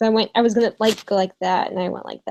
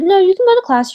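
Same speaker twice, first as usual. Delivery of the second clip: sharp dismissive mock, much higher and louder; mocking the director; 10 semitones higher, 7 dB louder, faster than usual. Retelling the director's second refusal, mock sharp and loud. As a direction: snap it like an impatient official. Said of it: "she said".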